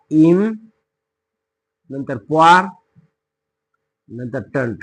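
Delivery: "इम", "प्वार", "टंट" each set apart by long pauses; syllable by syllable. Shown as a demonstration. Teacher repeats the word's split for pupils, part by part. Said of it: "त्याची आपल्याला फोड करायची आहे".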